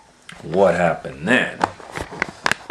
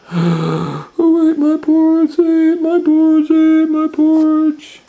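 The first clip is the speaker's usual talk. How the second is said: outraged bear; 9 dB louder than usual. Acted. Bear coming home to eaten porridge, high and wailing. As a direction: wail it in outrage.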